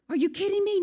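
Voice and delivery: high, loud disbelief; incredulous. Retold or imitated. Bystander pressing Peter again, loud disbelief. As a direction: demand it, shocked.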